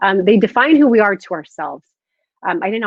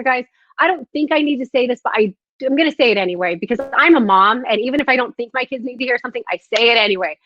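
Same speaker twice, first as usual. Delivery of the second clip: ordinary delivery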